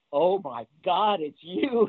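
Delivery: high, slow, awestruck Oh my God; breathless fan meeting his hero; starstruck gasp. Retold or imitated. Juggler recalls meeting his idol, high slow awestruck gasp. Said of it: "My exact words were".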